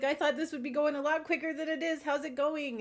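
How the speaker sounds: a chirpy celebrity greeting; bubbly TV host impression. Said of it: "he's like".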